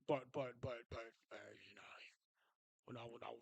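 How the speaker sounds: low, soft mock bass; lightly held mock deep voice; fake deep voice. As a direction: keep the fake deep voice going. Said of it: "I got the fake".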